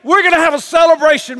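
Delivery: a father shouting for joy to his servants; booming glad command; rejoicing shout. Acted. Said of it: "as he's rejoicing".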